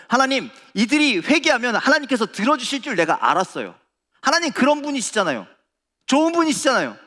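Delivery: angry, loud, high-pitched complaint; Jonah. Acted. Jonah complaining to God about sparing Nineveh, angry. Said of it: "성을 내면서, 즉 화를 내면서".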